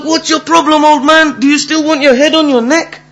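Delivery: high, loud acted outrage; angry confrontation. Slapped man squaring up to the old man, angry.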